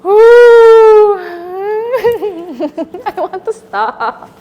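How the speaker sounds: loud high whoop into helpless laughing plea; panicky outburst. Real, not acted. Performer wanting the exercise over, high panicky outburst. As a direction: cry out high, then plead slowly through nervous laughter.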